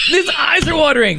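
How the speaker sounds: laughing. Real, not acted. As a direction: giggle the words out. Laughing at his reaction.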